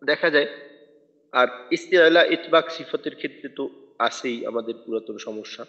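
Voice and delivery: ordinary talk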